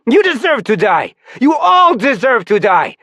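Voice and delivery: raging. Acted. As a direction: spit out the death wish. Rebel raging at everyone.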